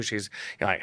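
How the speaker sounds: usual speaking voice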